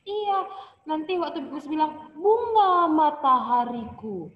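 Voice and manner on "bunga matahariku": "Bunga matahariku" with high rises, falling low at the end; high-to-low intonation. Shown as a demonstration. Teacher demonstrates poem intonation, "Bunga matahariku" going from high to low. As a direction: recite "Bunga matahariku", lifting the pitch then letting "hariku" sink. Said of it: "matanya tinggi".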